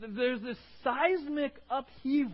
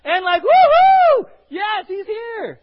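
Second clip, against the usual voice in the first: much higher, louder whooping cheer; jubilant cheering; 11 semitones higher, 15 dB louder, slower than usual. Retold or imitated. Preacher voicing the thrilled crowd greeting Jesus, jubilant shouting. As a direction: cheer like the liberator has come. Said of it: "a mixture of a plea".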